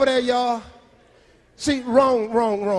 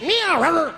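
cat and dog fight; 5 semitones higher than usual. Retold or imitated. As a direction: meow then barks, fast. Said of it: "that's a cat and a dog having a fight".

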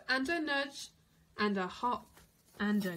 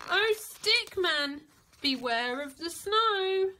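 higher, louder chanted warning; warning refrain; 6 semitones higher, 5 dB louder than usual. A narrator's reading. Narrator warning the floating Stickman, calling.

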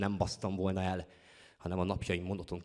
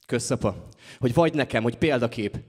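loud, raised, pointed thank-you; bitter mock thanks; 4 semitones higher, 11 dB louder than usual. Poet sarcastically thanks their father for being an example, loud mock gratitude. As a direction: throw the thanks out loudly, pitch climbing.

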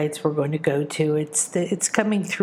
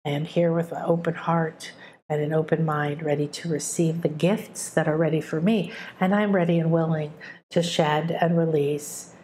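prayer. Acted. Speaks a prayer aloud.

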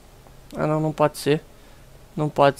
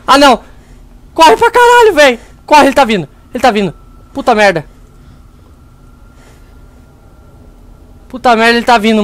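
panicked shouting; 3 semitones higher, 5 dB louder than usual. Real, not acted. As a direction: yell in real terror as it chases you. Monster chasing him in game.

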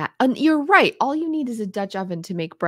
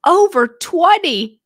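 exclaimed; 9 semitones higher, 9 dB louder, slower than usual. Real, not acted. Exaggerated count of vases.

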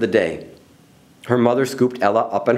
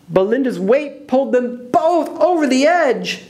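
high, urgent storytelling; suspenseful high-pitched narration; dramatic climax; 11 semitones higher than usual. A narrator's reading. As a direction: send the pitch soaring for the disaster. Climax of the fall.

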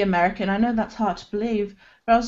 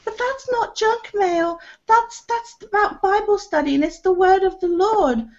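high, indignant preachy voice; a high, mocking imitation of the leaflet man; Jehovah's Witness; 11 semitones higher than usual. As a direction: mimic a prim, high-pitched churchgoer protesting. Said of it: "And he went".

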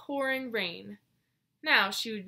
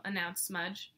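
usual speaking voice

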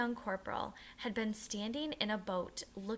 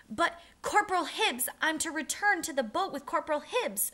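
high, loud desperate protest; frightened cry; 7 semitones higher, 6 dB louder than usual. A narrator's reading. Boy crying out to the captain as he is led away, high and desperate. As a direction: cry out in alarm. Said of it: "Matt cried".

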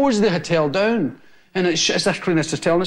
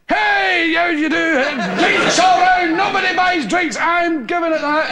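radically changed; 8 semitones higher, slower than usual. Retold or imitated. Garbage collector buying rounds for the bar, high excited imitation. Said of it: "he, he, he radically changed".

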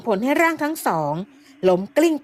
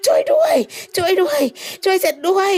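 screaming for help; 9 semitones higher than usual. Acted. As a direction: shriek for help while running. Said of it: "แหกปากร้องลั่น". (แหกปากร้องลั่นขอความช่วยเหลือ)

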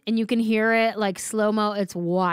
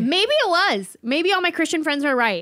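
high, louder sudden realisation; mock alarm; 8 semitones higher, 4 dB louder than usual. Podcaster reacting to talk of God's warning, high-pitched alarm. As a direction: seize on the idea in a raised pitch.

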